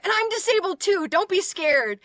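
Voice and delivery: very high, loud reassurance; high-pitched gushing mock reassurance; overeager fan. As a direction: imitate an overexcited stranger, squealing. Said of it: "They're like".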